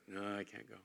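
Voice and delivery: firm refusal. Retold or imitated. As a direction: turn it down with finality. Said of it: "repeated refusal".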